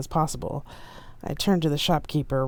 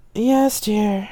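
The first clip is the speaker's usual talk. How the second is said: weary sigh; 7 semitones higher, 6 dB louder than usual. A narrator's reading. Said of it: "I said with a sigh".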